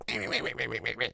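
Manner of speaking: rapid little mouse squeaks under the breath; mousy squeaking. Shown as a demonstration. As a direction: imitate a mouse chattering quietly. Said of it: "he sounds a bit more mousy".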